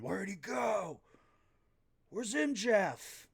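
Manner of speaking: high, loud mock yell searching for someone; hammed-up high frantic yell; frantic shout. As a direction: bellow it like a madman hunting his rival. Host voicing frantic Hangman backstage.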